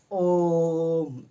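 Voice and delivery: ओम chanted on one very long held note; ओम stretched far beyond a long vowel; extra-long vowel. Shown as a demonstration. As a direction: draw ओम right out like a sage's chant. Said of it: "इसका उच्चारण करने में बहुत समय लगता है".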